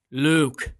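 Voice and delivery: low, slow looming Vader voice; Darth Vader impression. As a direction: pause after Luke, then sink deep and slow. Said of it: "that's how everyone remembers it".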